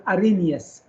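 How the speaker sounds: "Arrhenius" with no H sound; silent H. Shown as a demonstration. Teacher correcting students on the scientist's name, "Arrhenius" with silent H. We don't pronounce the H.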